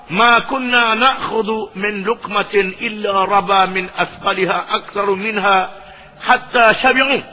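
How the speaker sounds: slow, measured Arabic reading; slow solemn Arabic recitation; hadith recitation. Acted. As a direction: read the sacred text deliberately.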